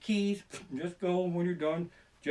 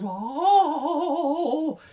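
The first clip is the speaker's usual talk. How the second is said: high yelp; 10 semitones higher, 7 dB louder than usual. Real, not acted. Exclamation after the rant.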